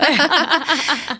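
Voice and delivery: light laugh. Real, not acted. Host laughs at soja joke.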